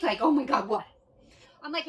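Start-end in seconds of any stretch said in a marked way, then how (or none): 0.2-0.9 louder, alarmed retort; mock alarmed husband; startled husband. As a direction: react to a sudden scream in the car. Husband startled while driving.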